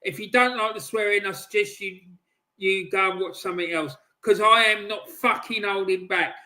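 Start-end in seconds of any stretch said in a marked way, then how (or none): none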